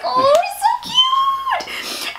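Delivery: very high, drawn-out squealing coo; gushing squeal. Real, not acted. Fan melting over giddy Hook, squealing. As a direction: swoon over him, stretching it way up high.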